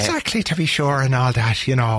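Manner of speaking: hammed-up mock Irish accent; bad Hollywood Irish. Shown as a demonstration. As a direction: parody a stage Irishman. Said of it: "bring the pitch of their voice up".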